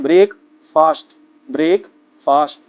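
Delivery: ब्रेक and फास्ट split apart, rising; syllable drill. Shown as a demonstration. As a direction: chop it into brek, fast. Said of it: "अब बोलते हैं".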